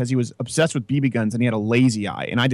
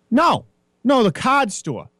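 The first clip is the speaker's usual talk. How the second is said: higher-pitched mock Boston accent, card as kahd; 9 semitones higher, 3 dB louder, slower than usual. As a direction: lightly imitate a Boston local insisting. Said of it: "they were severe Boston accents".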